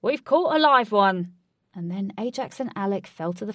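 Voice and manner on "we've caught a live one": high, loud triumphant cry; shout. A narrator's reading. Alec to Ajax with a fish-like catch, excited shout. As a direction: yell it as the line pulls tight. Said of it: "Alex shouted".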